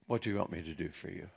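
soft, low and quick; gentle question. Acted. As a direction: answer the beggar quietly, as Jesus. Jesus gently answers blind man.

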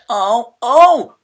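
loud, high alarmed cry; he panics at the mix-up; dismayed cry. A narrator's reading. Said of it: "cried Mister Pine".

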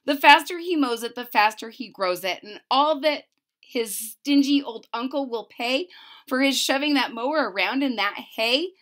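plain reading voice

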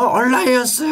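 high mock faction cheer. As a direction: imitate fans calling out their side. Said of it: "막 소리쳐". (높은 목소리로 외치는 진영 구호 흉내)

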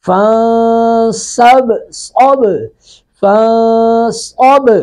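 فن صب very slow, the nun of فن hidden nasally and the ba of صب bounced; ikhfa and qalqala. Shown as a demonstration. Teacher shows students ikhfa and qalqala in فن صب. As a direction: hide the nun in فن and bounce the ba in صب, slowly. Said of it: "اس لفظ کے اندر دو چیزیں ہیں".

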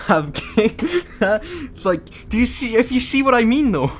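high, loud, cracking up mid-sentence; losing it at your own absurd argument; laughing. Real, not acted. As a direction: plead your case through real laughter.

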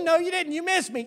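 put-on high loud childish taunt; kid protesting. A boy tells his playmate he missed, high defiant shout. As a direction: insist like a stubborn kid at play. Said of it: "I'd say".

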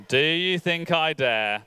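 ordinary talk, nothing marked

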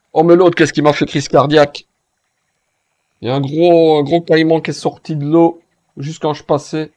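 loud, agitated retelling; excited. Real, not acted. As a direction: still jolted, words tumbling out loud. Recounting a crocodile jump scare.

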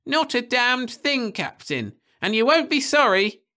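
put-on high, excited old man's cry; old Nibley crying out high and eager; cried out. A narrator's reading. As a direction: cry out in glad relief. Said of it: "cried Nibley".

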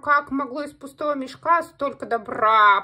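loud, slow cawing crow; puzzled crow. A narrator's reading. Angry crow wonders at the full table, loud and slow. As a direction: caw in baffled wonder. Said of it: "А злая ворона удивляется".

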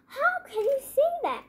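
high-pitched protest; arguing. A narrator's reading. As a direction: object as if in a squabble. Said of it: "argued the fourth blind man".

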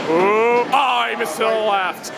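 normal talking voice

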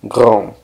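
grand with no D at the end; silent D. Shown as a demonstration. Teacher models the masculine word, grand with silent D. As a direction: stop grand on the nasal vowel, D unsounded. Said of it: "y la D no suena".

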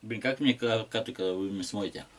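ordinary talk, nothing marked

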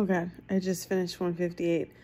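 usual speaking voice

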